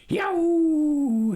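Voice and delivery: high yelping yell, half laugh half agony; yelled yow. Acted. Coyote stranger yelping at the farmers, wild yell. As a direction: let out a wild yelp. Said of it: "He let out a yell".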